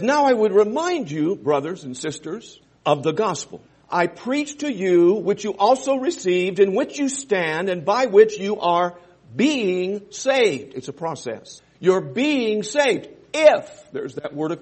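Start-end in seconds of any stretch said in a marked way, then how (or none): 0.0-10.7 loud, slow, solemn reading of scripture. Acted. Preacher reading scripture to the church, slow and loud. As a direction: proclaim the verse to the congregation.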